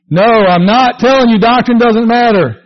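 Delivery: ordinary talk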